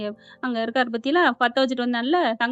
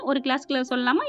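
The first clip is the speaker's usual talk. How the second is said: usual speaking voice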